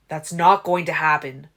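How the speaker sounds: loud defiant shout. A narrator's reading. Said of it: "I was shouting again".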